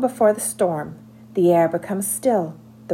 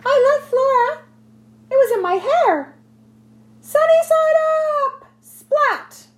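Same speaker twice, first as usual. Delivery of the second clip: high, excited little squirrel voice; 4 dB louder than usual. Acted. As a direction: chirp it out like a giddy squirrel. Squirrel's poem voice.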